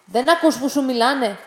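loud, sharp, out of patience; man from the boat loses patience with the silent boy; impatient anger. Acted. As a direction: snap at a kid who won't answer. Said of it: "έχασε την υπομονή του".

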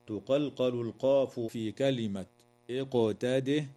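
usual speaking voice

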